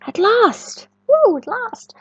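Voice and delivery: eager cheer as the egg cracks; excited. A narrator's reading. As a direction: burst out with delight.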